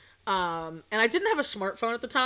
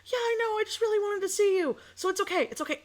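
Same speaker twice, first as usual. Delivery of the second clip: high mock boyfriend voice; 11 semitones higher, faster than usual.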